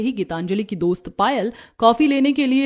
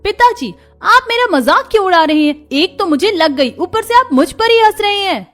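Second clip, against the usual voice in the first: high, loud hurt complaint; indignant daughter; upset protest; 10 semitones higher, 6 dB louder than usual. A narrator's reading. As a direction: scold father for laughing. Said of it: "Gauri को गुस्सा होता देखकर".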